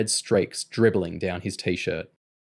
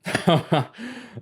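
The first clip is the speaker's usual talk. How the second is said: high acted laugh; hearty mocking laugh; 8 semitones higher than usual. A narrator's reading. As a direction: laugh at the boy's fear. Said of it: "Mister Large laughed".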